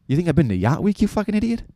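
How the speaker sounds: incredulous rant climbing high at the end; hammed-up exasperation, pitch rising into the insult; mock indignation. Acted rant at the questioner.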